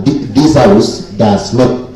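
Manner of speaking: slowly, weighing every word, leaning on "does not"; stress on does not. Real, not acted. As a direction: draw out "this House does not" deliberately. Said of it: "I want the members to underline the word".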